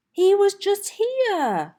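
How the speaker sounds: loud, high, slowed outburst; upset little dinosaur shouting. Acted. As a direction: blurt it out in frustration. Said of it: "I didn't mean to shout".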